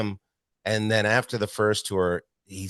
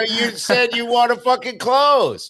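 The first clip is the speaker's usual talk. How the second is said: squeaky high taunting voice, slow, much higher; mocking high whine; 8 dB louder, slower than usual. Comic mocking Wilmot's complaint in a squeaky high taunt. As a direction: whine it back at him like a brat.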